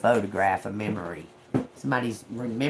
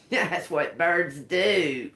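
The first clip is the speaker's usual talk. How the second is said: laughing; 5 semitones higher, much slower than usual. Real, not acted. Laughing at the bird.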